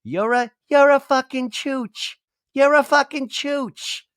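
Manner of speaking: put-on very high, childish voice; little girl's voice. Little girl cursing on a home video, very high kid voice. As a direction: swear like a tiny kid. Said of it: "the little girl saying".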